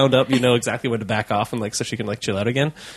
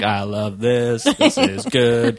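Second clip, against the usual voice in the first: cat voice; slower than usual. Retold or imitated. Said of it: "She's like".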